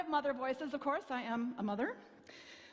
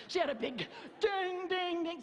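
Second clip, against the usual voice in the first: bell imitation; 6 semitones higher than usual. Imitating Johnsons' dinner bell.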